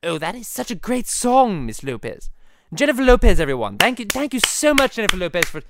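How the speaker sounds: high, loud, gushing cartoon host; Stewie. Stewie thanking Jennifer Lopez for visiting, high gushing voice. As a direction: thank the guest with big showy enthusiasm. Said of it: "the Stewie and Peter podcast".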